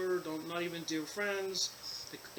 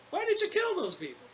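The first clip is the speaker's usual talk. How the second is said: much higher, louder stern divine question; God demanding an answer; 13 semitones higher, 5 dB louder than usual. Retold or imitated. God questioning the man who shot the visitors, high and accusing. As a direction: ask it as God judging the sinner, pitched way up. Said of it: "God's gonna go".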